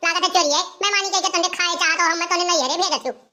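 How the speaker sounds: ordinary talk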